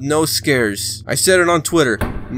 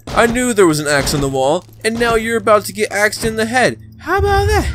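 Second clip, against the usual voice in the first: mock threat; 6 semitones higher, 3 dB louder than usual. Taunting Bendy with axe.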